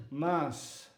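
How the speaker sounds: usual speaking voice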